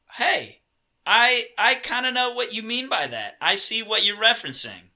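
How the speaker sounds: high-pitched voice of happy recognition; imagined delighted viewer. Retold or imitated. Voicing a viewer's pleased recognition.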